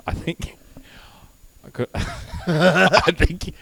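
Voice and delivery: slow, breaking into laughter; real laughter mid-sentence; laughing. Real, not acted. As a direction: laugh through the words. Laughing at the memory.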